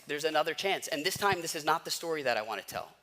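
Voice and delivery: ordinary delivery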